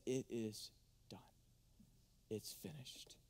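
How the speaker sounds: soft unvoiced whisper; God's final words, whispered. God's words voiced in hush.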